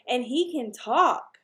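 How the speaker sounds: excited. Acted. Fudge boasting about his bird.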